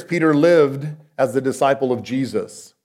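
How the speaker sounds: normal talking voice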